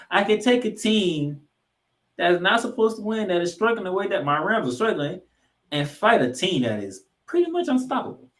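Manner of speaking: a fan standing up for his team; passionate defence. Real, not acted. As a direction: pitch it high and earnest.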